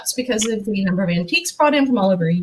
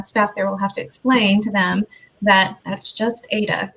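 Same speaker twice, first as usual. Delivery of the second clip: ordinary talk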